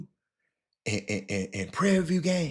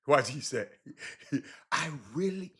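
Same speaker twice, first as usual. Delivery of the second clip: usual speaking voice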